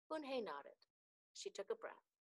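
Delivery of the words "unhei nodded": ordinary delivery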